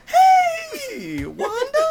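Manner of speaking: loud, drawn-out falsetto hello; over-eager greeting. Retold or imitated. Comedian as Larry caught at the door greeting Wanda, squealed hello. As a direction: squeal a stretched, fake-friendly greeting.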